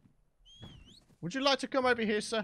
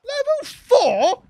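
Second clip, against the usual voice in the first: high, loud, slow scoff; disgusted disbelief; 14 semitones higher, 9 dB louder, much slower than usual. Real, not acted. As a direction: drag it out in disbelief. Low-level Argentavis disgust.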